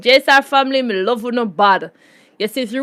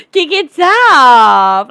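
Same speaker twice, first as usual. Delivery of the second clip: loud, very drawn-out holler; hyped call-out; 3 semitones higher, 7 dB louder, much slower than usual. Real, not acted. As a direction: stretch it into a long shouted sign-off. Hyped sign-off catchphrase.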